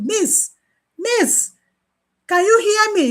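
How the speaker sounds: high, loud calling, building. Retold or imitated. Patient calling loudly to the nurse for attention. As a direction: call out to get her attention.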